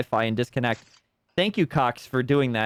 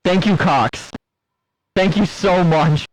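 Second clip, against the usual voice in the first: sarcastic shout; 3 semitones higher, 7 dB louder than usual. Real, not acted. Sarcastic thanks to internet provider.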